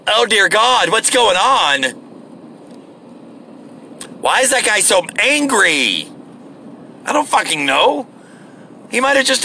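high, mock-alarmed gasping bystander; fretful bystander. Mocking naive bystanders watching a fight, high and alarmed. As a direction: ham up a clueless onlooker's panic. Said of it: "the bystanders going".

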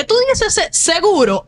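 high, skeptical seguro; sarcastic imitated doubt; mock doubt. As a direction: pretend to doubt them. Mocking doubtful reply.

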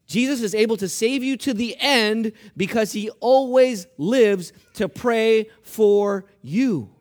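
loud, emphatic scripture declaration, slowing at the end; forceful preaching. Acted. Preacher proclaiming Hebrews to the congregation, forceful and emphatic. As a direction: proclaim it word by word, building in weight.

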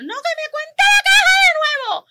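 high, slow, laughing shout; mock yell. Customer yelling at cashier to count the register again. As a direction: shout it through laughter like the outraged shopper.

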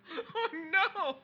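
high, loud mock horror with a laugh; joking about paunch cookies, high mock horror. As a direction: gasp in fake dismay, laughing.

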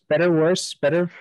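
usual speaking voice

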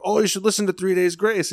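high, quick-flowing pitch of a band; eager recommendation. Retold or imitated. Friend recommending music to a streamer, eager. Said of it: "his friend was recommending him music".

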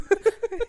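high, loud laughing yelp; loud slapstick yelps. Real, not acted. Friends cracking up over the story.

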